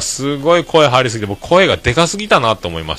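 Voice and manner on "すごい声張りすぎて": ordinary talk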